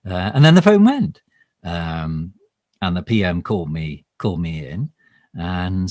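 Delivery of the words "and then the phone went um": ordinary talk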